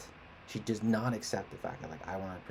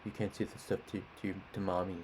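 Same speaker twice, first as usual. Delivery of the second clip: lightly voiced dad talking down; a hint of babying parent; imitating dad. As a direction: mildly mimic dad scolding a child. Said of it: "he still talks like this".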